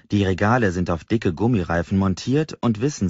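plain reading voice

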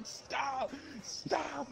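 high yelping stop stop; retold pleading yell. Friend pelting him, he yelps to stop. Pelted with things, begging him.

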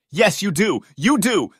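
high, loud angry insistence; heated, high-pitched shout; aggressive screaming. A narrator's reading. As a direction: cut in and yell it. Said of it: "they scream".

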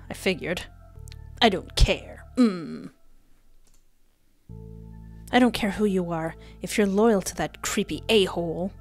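ordinary talk, nothing marked

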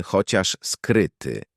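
plain reading voice

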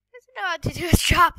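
almost shouting, indignant and rushed; loud exasperated outburst. Real, not acted. Gamer yelling at a useless player, loud outburst. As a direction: blurt it out loud and fast in disbelief.

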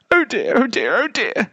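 high, worried little cries; high-pitched flustered bear; fretting polar bear. A narrator's reading. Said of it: "said the polar bear".

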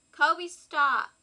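sharp loud call to the dog; scolding the dog. Real, not acted. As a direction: snap a firm stop at the pet. Scolding the dog mid-tutorial.

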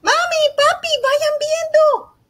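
put-on excited child calling. Child to parents on a drive, excited calling. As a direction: call out like an excited little kid spotting something. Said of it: "tú le puedes decir a mamá o a papá".

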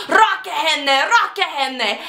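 high, dramatic repeated plea, mock yell; in-character stage cry. Student still in character on stage, high dramatic cry. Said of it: "still in character".

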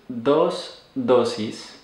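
slow teaching pronunciation with a gap between words; slow, careful Spanish. Shown as a demonstration. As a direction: say each word separately and unhurriedly. Slow model of the Spanish phrase.

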